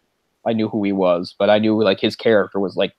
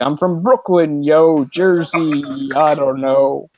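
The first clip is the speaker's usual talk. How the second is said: hammed-up, wandering American accent, drawn out; slow mock Brooklyn-to-Jersey American accent; all-over-the-place American accent; 4 semitones higher, 4 dB louder, slower than usual. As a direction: exaggerate a muddled East Coast American accent. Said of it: "this all over the place American accent".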